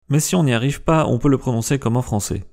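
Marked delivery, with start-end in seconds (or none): none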